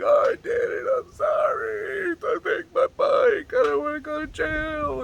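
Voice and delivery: mock crying plea. Eleven-year-old begging the officer not to go to jail, high wailing. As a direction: ham up a panicked kid begging the cop. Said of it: "I just give out and start crying".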